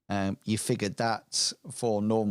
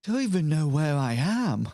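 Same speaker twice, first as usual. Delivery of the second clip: higher character voice; bewildered lost boy voice; NPC voice; 6 semitones higher, 4 dB louder than usual. Acted. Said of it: "He says".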